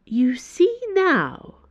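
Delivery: very slow, high, pointed delivery; imperious Cardinal. Acted. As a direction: draw it out as a superior making a point. Cardinal after a long silence.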